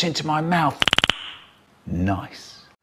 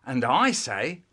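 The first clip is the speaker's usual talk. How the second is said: ordinary delivery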